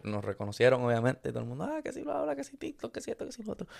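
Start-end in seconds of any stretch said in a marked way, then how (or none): 1.6-3.7 high, hushed gushing fan voices; excited fans chatter. Retold or imitated. Retelling fans outside the club, a high hushed gushing chatter.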